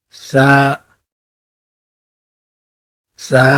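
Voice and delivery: سا said as tha, the tongue between the teeth; Arabic tha demo. Shown as a demonstration. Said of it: "ہونٹ ہیں دانتوں کے درمیان".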